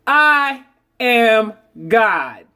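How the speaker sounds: very slow, each word set apart; slow affirmation. Shown as a demonstration. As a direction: lay it down slowly like a creed.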